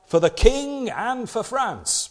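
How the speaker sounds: a hint of a royalist soldier's call; mock battle cry. Preacher voices the Bourbon soldiers: slow mock battle cry. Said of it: "were known to cry out".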